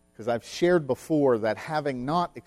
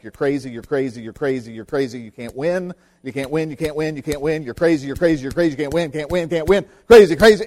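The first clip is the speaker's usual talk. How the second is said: relentless chant, building louder to a shout; pounding taunt; 2 semitones higher, 8 dB louder, much faster than usual. Retold or imitated. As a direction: hammer the words over and over, getting louder. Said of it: "and this is what it sounded like".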